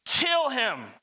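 high, emphatic cry falling away; crowd's shout. A narrator's reading. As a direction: call it out like a mob's demand. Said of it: "With one voice they shouted".

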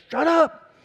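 high, sharp mock yell of shut up; retold yell. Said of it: "just yells at everybody in the van".

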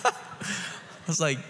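slow, airy, laughing words; laughing through words. Real, not acted. Still laughing.

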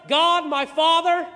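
loud, high call to God; ringing invocation, slowing at the end; praying out loud. Acted. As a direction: lift the voice in public prayer. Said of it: "and he prays out loud".

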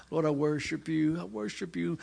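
softly imitating a devout prayer; mock worshipful murmur. Preacher trying to worship despite distraction, soft prayer. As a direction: pray it softly, acting absorbed. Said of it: "trying to worship the Lord".

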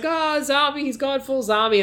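mock panicked crowd.

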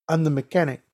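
put-on restrained Spanish-accented statement; Spanish accent, before any agitation. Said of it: "he has a heavy Spanish accent".

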